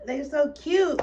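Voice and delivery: loud gushing squeal; excited squeal. Real, not acted. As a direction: squeal it out, overwhelmed by cuteness. Gushing over the puppies.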